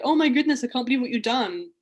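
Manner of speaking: mock-amazed, high and loud; hammed-up disbelief; gushing amazement. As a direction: imitate an awestruck American stranger. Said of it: "everyone was very much like".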